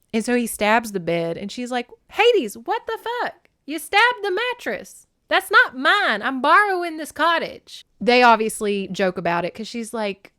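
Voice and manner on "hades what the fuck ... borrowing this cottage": high, slow indignant shriek; outraged Persephone. Retold or imitated. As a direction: scold him in a high squawk. Persephone yells at bed stabbing.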